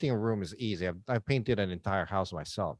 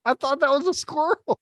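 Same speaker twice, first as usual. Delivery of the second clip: laughing; 4 dB louder than usual. Real, not acted. Laughing at his own mistake.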